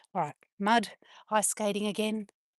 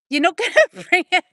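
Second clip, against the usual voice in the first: laughing; 8 semitones higher, 10 dB louder than usual. Real, not acted. Laughing at the kids' find.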